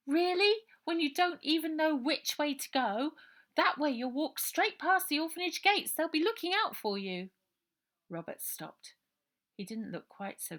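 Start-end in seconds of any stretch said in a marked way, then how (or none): 0.1-7.3 put-on much higher, louder girl, sharp and knowing; girl Ida. Ida warning Robert about the orphanage gates, high girl voice. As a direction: needle him with the facts.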